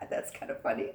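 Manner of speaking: breathy laugh into a giggled remark. Real, not acted. Teacher laughs at a boy's mouse wish, giggling.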